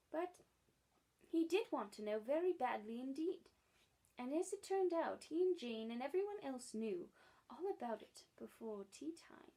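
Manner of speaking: plain reading voice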